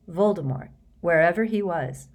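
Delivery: usual speaking voice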